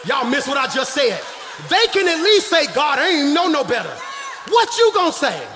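high, shouted rebuke; full-force preaching. Real, not acted. Preacher telling churchgoers others can claim ignorance, shouted preaching. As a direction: challenge the church at the top of your voice.

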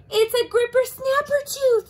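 high, loud alarmed cry; frightened shout. Acted. Leopard shouting the monster's name at Anteater.

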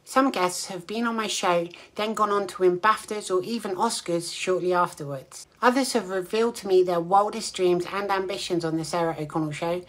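soft, even presenter narration; quiet host introducing the next clip; voiceover read. Acted. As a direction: read the link like a trailer voiceover. Show host reads a voiceover link.

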